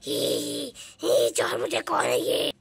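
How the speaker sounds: low, quiet growling witch; deep witch voice. Acted. Witch snarling at the confused father, low growl. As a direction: menace him in a low growl.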